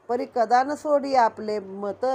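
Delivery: usual speaking voice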